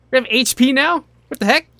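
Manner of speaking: sudden high, loud what-is-this yelp; shocked outburst. Real, not acted. Streamer startled by health bar in the toilet, shocked yelp. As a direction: blurt it out in real surprise.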